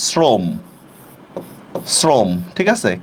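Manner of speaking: শ্রম said shrom with closed ও; correct pronunciation. Shown as a demonstration. Teacher modelling the word for the class. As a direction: round the vowel in শ্রম. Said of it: "উচ্চারণটা হবে এভাবে".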